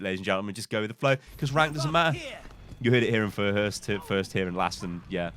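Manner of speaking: ordinary talk, nothing marked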